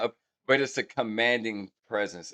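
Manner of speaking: ordinary talk, nothing marked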